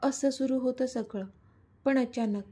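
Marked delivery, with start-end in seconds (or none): none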